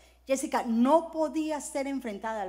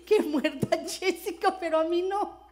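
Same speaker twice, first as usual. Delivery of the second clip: laughing, louder and higher; laughing mock confession; 5 semitones higher, 5 dB louder than usual. Pastor joking to congregation about her cowardice, laughing.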